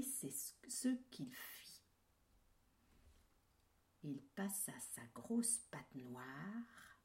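whisper. A narrator's reading. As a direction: whisper it with suspense. Ominous narration.

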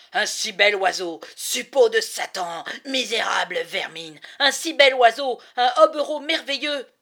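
loud, exultant, ending in a laugh; gloating rapture. Acted. Falconer gloats over the beautiful hawk, loud and laughing. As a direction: crow over the bird like a prize.

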